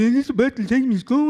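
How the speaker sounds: put-on little boy asking the bus driver, lisping; lisping kid. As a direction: play yourself as a lisping child. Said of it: "I had a lisp".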